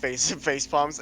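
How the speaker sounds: ordinary talk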